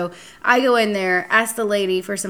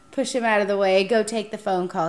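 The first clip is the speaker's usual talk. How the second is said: usual speaking voice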